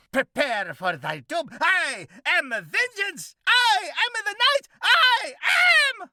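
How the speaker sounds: high, loud, mock-heroic vigilante voice; hammed-up cockroach hero. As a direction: declaim like a pompous masked avenger. Cockroach proclaims himself.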